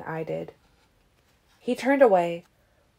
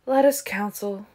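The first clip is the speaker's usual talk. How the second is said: indifferent echo of a proposal; uninterested; 3 semitones higher than usual. A narrator's reading. Marcus echoes Caius in an uninterested tone. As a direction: repeat it as if you could not care less. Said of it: "Marcus repeated in an uninterested tone".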